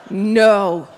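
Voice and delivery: loud mock denial at normal pitch; loud refusal. Phone agent rejects a guess, loud mock refusal.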